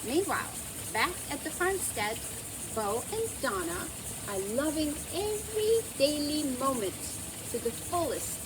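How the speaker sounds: ordinary delivery, nothing marked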